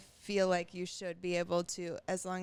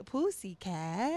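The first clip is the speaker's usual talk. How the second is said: imitating a toddler, louder and drawn out slowly with a rising end; kid repeating a word; 5 dB louder, slower than usual. Mom imitating her son in the car, a slow, loud childish sing-song. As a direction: stretch it out like a kid who thinks he's hilarious. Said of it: "He thought he was so funny".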